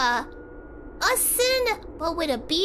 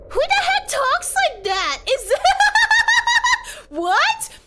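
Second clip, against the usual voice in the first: high, loud disbelief collapsing into laughter; incredulous laughing outburst; 14 semitones higher, 6 dB louder, slower than usual. Real, not acted. Streamer hearing absurd slang for food, loud incredulous laughter.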